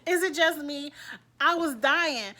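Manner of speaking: loud excited laughing talk; bursting with excitement, loud. Real, not acted. Cracking up retelling.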